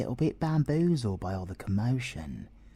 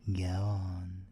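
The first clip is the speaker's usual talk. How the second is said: usual speaking voice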